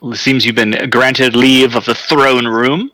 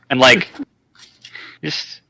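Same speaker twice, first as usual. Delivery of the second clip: ordinary delivery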